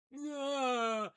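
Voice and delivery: high, loud, drawn-out yeah; stretched-out celebratory whoop; mock cheer. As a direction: hold one long high note of mock triumph. Acted reaction to breaking even.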